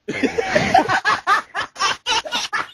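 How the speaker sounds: high laughing fit. Real, not acted. Cracks up at own line.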